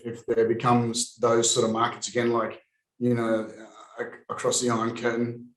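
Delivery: normal talking voice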